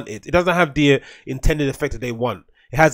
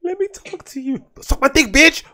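much higher mock girl voice, taunting; imitating the crazy girl; 12 semitones higher than usual. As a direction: parrot her high and mocking. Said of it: "This just so schizo".

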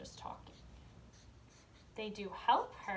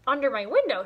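put-on bright, louder girl's voice; excited little girl reading; child's voice; 3 semitones higher, 11 dB louder, faster than usual. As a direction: read Emmy eagerly, a little louder. Emmy's voice in story.

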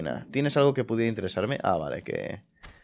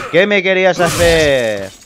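loud, slow, higher taunt; drawn-out taunting shout; 4 semitones higher, 8 dB louder, slower than usual. Real, not acted. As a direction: stretch the taunt out loudly. Taunt repeated louder, drawn out.

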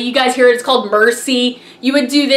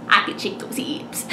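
soft playful whisper; cheeky whisper; 4 semitones lower, 8 dB quieter than usual. Real, not acted. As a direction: whisper it with a wink. Cheeky joke about her hips.

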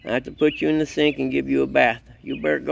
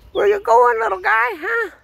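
high pet talk; 3 dB louder than usual. Real, not acted. Owner calling after his running puppy in a much higher voice. Same question, squeaky pet voice.

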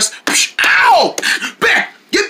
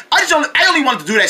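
ordinary talk

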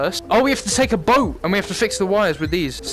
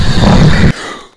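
sharp gasp; 11 dB louder than usual. Real, not acted. Spirit box answers.

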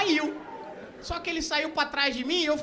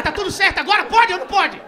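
high, loud demand for confirmation; exasperated check; 4 semitones higher, 7 dB louder than usual. Acted. Asking the crew if he can proceed, exasperated. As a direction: press the crew impatiently.

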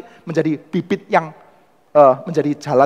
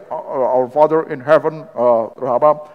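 slow, solemn English prayer; slower than usual. Retold or imitated. Wycliffe's followers praying in English, slow and solemn. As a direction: read the prayer slowly and reverently. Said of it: "Begitu orang pakai bahasa Inggris".

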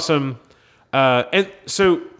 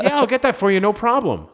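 obliging friend; 7 semitones higher than usual. Retold or imitated. Sean voiced replying kindly.

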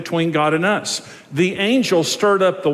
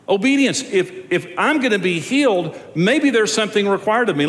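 higher, ringing preacher's call; impassioned sermon delivery; preaching at full force; 4 semitones higher than usual. Acted. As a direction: drive the point home to the congregation. Preaching builds higher and louder.

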